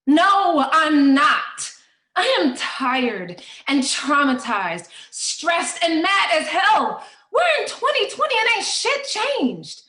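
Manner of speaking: loud, high, exasperated tirade; fed-up shout at full force; angry outburst. Acted. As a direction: let the anger burst out, rising in pitch. Answering are you okay, fed up.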